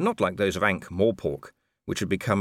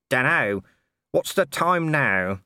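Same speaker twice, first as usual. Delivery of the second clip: higher, offhand slow drawl of a cheeky corporal; lightly voiced Nobby; 6 semitones higher, 4 dB louder, slower than usual. A narrator's reading. Nobby ambling in and teasing Colon, offhand. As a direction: saunter in unbothered.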